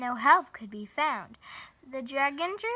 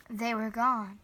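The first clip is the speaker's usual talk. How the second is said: ordinary delivery, nothing marked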